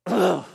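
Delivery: loud mock groan of disgust; acted guttural scoff; guttural ugh. Shown as a demonstration. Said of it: "just a guttural response".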